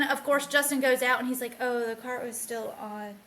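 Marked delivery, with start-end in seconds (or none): none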